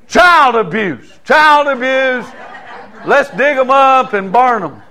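loud, high, slow mock protest; mock outrage. Preacher mocking the congregation's soap complaints, loud mock outrage. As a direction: ham up the cry of abuse.

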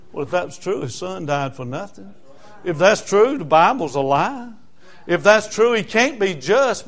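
ordinary talk

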